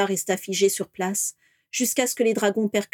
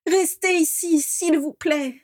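desperate pleading; 8 semitones higher, slower than usual. Acted. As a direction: plead with everything left. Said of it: "supplia Vera".

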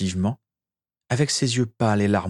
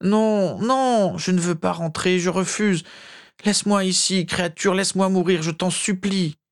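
very high anguished wail; desperate pleading; 11 semitones higher than usual. A narrator's reading. Master refusing to drink and begging his elf, desperate high plea. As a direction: beg in high, tormented cries. Poisoned master begs to die.

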